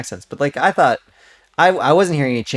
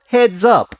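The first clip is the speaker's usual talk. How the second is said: put-on Russian accent; 9 semitones higher, 4 dB louder than usual. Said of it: "Is that the word?".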